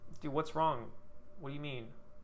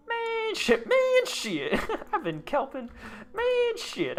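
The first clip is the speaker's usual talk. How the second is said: very high, squeaky laughing curse; giggling squeal; 5 dB louder, much slower than usual. Real, not acted. Streamer laughing at his own kelping mistake, squeaky giggles. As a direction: squeal it out through real giggles. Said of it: "I don't know why that's funny".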